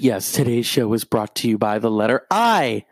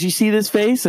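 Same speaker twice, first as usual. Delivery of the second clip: mocking threatening woman; 9 semitones higher than usual. Rude woman voiced.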